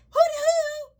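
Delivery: high, loud sing-song hoo-dee-hoo; mock preacher whoop. Parodying the Bible's warning, a high mock whoop.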